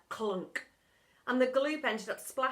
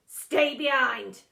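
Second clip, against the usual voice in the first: slow, loud command; stern order; 11 dB louder, slower than usual. A narrator's reading. Teacher ordering the boy to stay, stern. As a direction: lay down the order.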